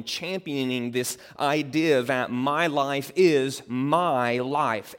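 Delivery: "my" pressed hard both times, slow; stress on my. Shown as a demonstration. Preacher quoting a self-centred creed, stress on "my". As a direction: lean on each "my", drawn out. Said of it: "Emphasis on the word my".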